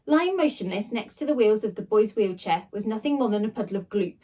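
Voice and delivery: usual speaking voice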